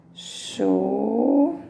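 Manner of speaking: "Chuveiro" with long pauses, chu, vei, ro; "Chuveiro" in three isolated, stretched syllables; syllable breakdown. Shown as a demonstration. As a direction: sound out "Chuveiro" very slowly. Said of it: "separou em trissílabo".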